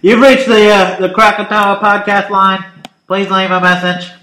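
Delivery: pinched-nose answering machine voice; nasal voice. Acted. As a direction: hold your nose and play the voicemail. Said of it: "Why are you plugging your nose?".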